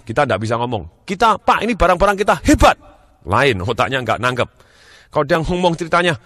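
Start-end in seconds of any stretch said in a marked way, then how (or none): 1.0-2.8 loud, high-pitched boast; hammed-up eager seller. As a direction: pitch it loudly with big enthusiasm. Acts over-eager seller answering. (penjual bersemangat, lantang dan tinggi)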